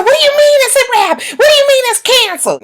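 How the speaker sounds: high, fast, crying whine; whiny mock Diamond. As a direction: mimic her panicked pleading. Mocking Diamond's reaction.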